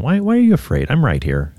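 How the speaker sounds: put-on calm a frightened child; comforting a child. Shown as a demonstration. Parent reassuring a scared child, tender. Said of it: "to your children".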